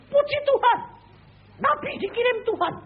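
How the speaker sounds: high, loud joyful praise; joyful exclamation. Retold or imitated. Prisoner praising God for the prophet, high and loud. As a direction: cry out praise in delight.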